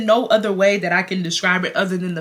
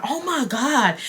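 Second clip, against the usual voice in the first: mildly excited, pitched up; retold excited gasp of words; retold excitement; 4 semitones higher, 3 dB quieter, slower than usual. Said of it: "he starts getting very excited".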